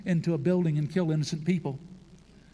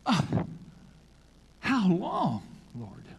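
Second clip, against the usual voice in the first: dismayed plea; 5 semitones higher, slower than usual. Acted. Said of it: "and he says".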